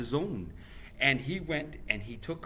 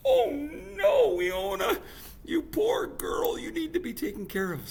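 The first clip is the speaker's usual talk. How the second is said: high tearful cry, then a whisper; pitying cry; 4 dB louder than usual. Acted. Grandmother pitying her daughter, high tearful voice. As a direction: grieve over the poor girl. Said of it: "she was like".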